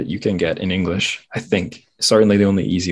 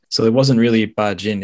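ordinary delivery

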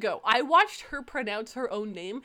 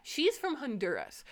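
ordinary talk

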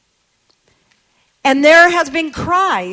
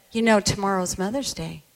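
much softer, quick gentle reminder; gentle hushed greeting; 6 semitones lower, 8 dB quieter, faster than usual. Retold or imitated. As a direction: lean in and say it softly to her. Same greeting, intimate moment.

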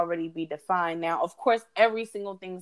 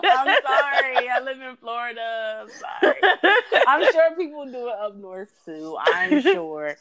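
high, laughing through the words; laughing apology; 5 semitones higher, 4 dB louder than usual. Real, not acted. Host teased by co-host about her accent, laughing. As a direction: apologize while cracking up.

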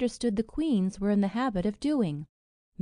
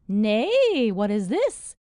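loud, playful mock surprise; smiling surprise; 7 dB louder, slower than usual. A narrator's reading. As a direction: exclaim it with a smile in the voice. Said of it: "said she, smiling".

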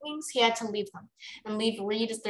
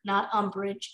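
ordinary delivery

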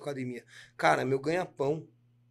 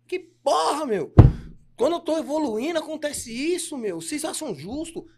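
high, loud mock outburst at the spirits; retold cursing; 12 semitones higher, 9 dB louder than usual. To the spirits, complaining about his bad luck, retold angry outburst. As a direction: rant like you're scolding the gods. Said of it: "Cheguei lá xingando todo mundo".

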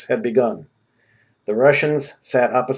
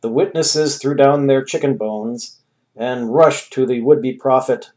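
ordinary delivery, nothing marked

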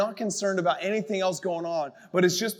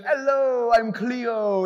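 higher, light Jamaican TV psychic greeting; imitating a Jamaican accent; 4 semitones higher, much slower than usual. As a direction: do a quick Jamaican psychic hello. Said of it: "And she had that accent".

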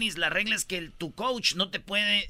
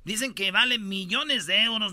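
usual speaking voice

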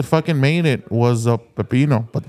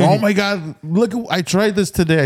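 excited; 5 semitones higher than usual. Retold or imitated. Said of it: "I'm like".

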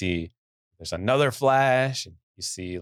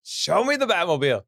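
high, exasperated fan demand; mock-mad demand; 9 semitones higher than usual. Fan demanding a reveal from the trailer, mock exasperated. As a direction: act out a frustrated fan's plea, pitched way up. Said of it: "I was so mad".

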